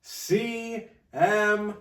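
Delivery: slow, loud and high ring intro; hype announcer. Acted. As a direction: build it up like an announcer. Hyping Punk's entrance like announcer.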